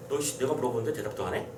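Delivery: low, quick, softened grumble; low-voiced indignant demand for an answer; mock indignation. Teacher voicing a man whose question went unanswered, indignant. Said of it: "이런 느낌인 거잖아".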